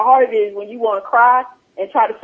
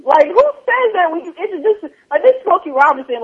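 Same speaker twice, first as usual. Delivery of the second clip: exasperated outrage; 9 semitones higher, 4 dB louder than usual. Real, not acted. Outraged at the pastor's remark.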